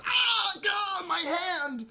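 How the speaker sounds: high, slow hammed-up cry of pain; mock pained yell. Retelling a manga fight, hammed-up pained yell. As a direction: yell it like a cartoon hurt hand. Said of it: "and then goes".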